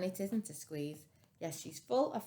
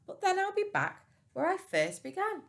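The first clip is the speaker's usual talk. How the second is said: high, louder sly reassurance; wise old man; 13 semitones higher, 5 dB louder than usual. Acted. Storyteller voicing the wise old man's plan. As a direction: promise it knowingly.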